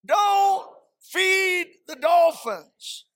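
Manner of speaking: loud, high, drawn-out slogan; slogan da ripetere. Shown as a demonstration. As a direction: belt out each word slowly for the crowd to repeat. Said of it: "Let's say it again".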